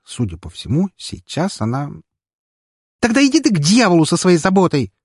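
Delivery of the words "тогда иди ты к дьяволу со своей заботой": high, loud furious shout. A narrator's reading. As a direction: shout him off and hang up. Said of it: "выкрикнула она".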